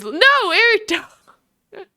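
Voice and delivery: high, slow laughing plea; pleading with the cat through laughter; laughing scold. Real, not acted. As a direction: laugh while telling the cat off.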